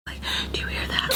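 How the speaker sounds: hushed, voiceless whisper; secretive retold whisper; whispered aside. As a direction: whisper it to a friend while eavesdropping. Whispering to friend while eavesdropping.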